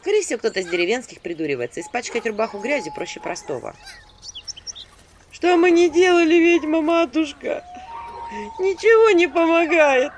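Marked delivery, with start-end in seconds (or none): 5.3-10.1 high, loud, slow whimpering lament; loud howling plea; whining wail. A narrator's reading. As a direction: whine at the witch for help. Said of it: "подвывали у меня под дверью".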